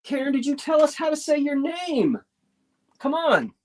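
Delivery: high-pitched calling out to someone; high, pleading mock impatience; playful exasperation. Real, not acted. Mock-impatient call to absent bidder.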